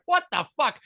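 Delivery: ordinary delivery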